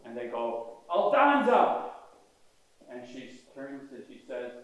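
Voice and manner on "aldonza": a loud, high call across the crowd; yelled name. Retold or imitated. Crowd member calls to Aldonza, loud high shout. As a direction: shout her name so she turns. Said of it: "somebody yells out to Aldonza".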